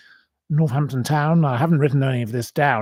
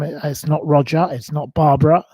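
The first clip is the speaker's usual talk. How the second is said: ordinary talk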